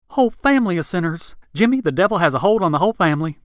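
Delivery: high-pitched, fervent outrage; alarmed preacher. Acted. Rick warns Jimmy of the devil's hold, high and scandalized. Rick condemns the family as sinners.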